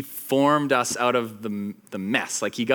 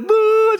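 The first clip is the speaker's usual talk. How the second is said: loud, high mock sob; hammed-up wail of a blubbering mess; mock bawling; 9 dB louder than usual. Telling coworker about the ending, mock bawling. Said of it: "just a mess".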